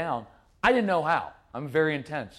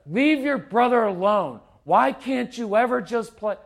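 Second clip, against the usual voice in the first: higher, louder mock scolding; exasperated parent nagging; 6 semitones higher, 5 dB louder than usual. Parent dumping on the kid, exasperated scolding. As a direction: nag the kid in a raised voice.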